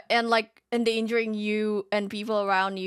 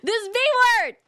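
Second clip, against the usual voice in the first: mock outrage; 10 semitones higher, 5 dB louder than usual.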